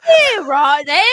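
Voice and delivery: loud mock British accent. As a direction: overplay a posh British farewell. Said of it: "My kink is British accents".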